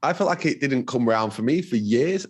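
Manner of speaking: ordinary delivery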